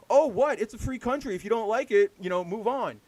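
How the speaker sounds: loud, high mock indignation at first; inconsiderate defiance. Mimicking inconsiderate people brushing off complaints, mock defiant. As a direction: play a dismissive jerk, overdone. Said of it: "people just being inconsiderate and pretending".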